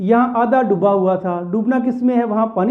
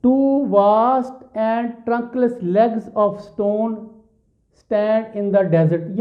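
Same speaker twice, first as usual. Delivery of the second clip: drawn-out recitation of a poem line; slow poem reading; much slower than usual. Shown as a demonstration. Teacher reading the poem line to students, very slow. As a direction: read each word of the verse slowly to the class.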